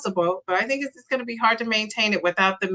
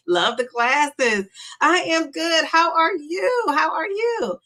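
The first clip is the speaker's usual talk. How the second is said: upbeat chat reading; 7 semitones higher than usual. Real, not acted. Reading chat messages aloud.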